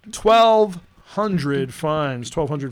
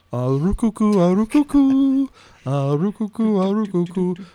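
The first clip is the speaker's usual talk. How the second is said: mock bird-call jingle; 4 semitones higher than usual. Host greets Canadian players, hammed-up cooing call.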